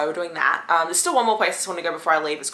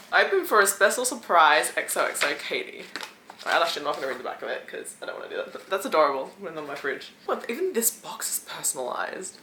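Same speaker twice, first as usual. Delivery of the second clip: usual speaking voice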